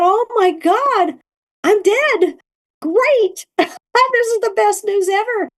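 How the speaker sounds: high, laughing glee at being dead; delighted realisation. Retold or imitated. To herself on realising she has died, high and gleeful. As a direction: squeal it out like the best news ever.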